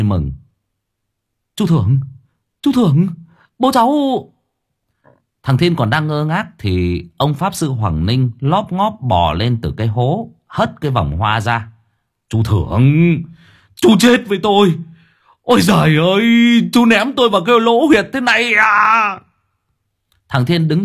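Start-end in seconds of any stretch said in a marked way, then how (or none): 1.6-3.9 put-on higher and higher, joyful child's cries; boy calling the uncle on hearing his father; excited calling. 12.3-19.2 high, loud indignant yelling; furious wail. Acted. Father crawling out, furious.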